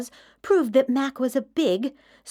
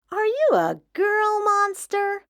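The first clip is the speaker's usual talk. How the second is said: put-on child voice; 9 semitones higher than usual. Kid asking, high.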